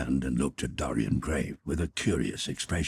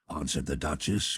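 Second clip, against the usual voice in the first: ordinary talk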